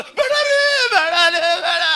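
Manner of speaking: very high, hammed-up wifely pleading. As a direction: squeal it like a wife running to stop her husband. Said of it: "ಹೆಂಡತಿ ಓಡಿ ಬರ್ತಾಳೆ".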